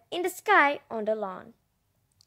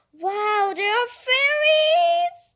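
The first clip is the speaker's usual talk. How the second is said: high, loud sung-out delight; excited squeal; 11 semitones higher, 6 dB louder, much slower than usual. Real, not acted. Child spotting fairies in the book, squealing. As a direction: sing it out in pure joy.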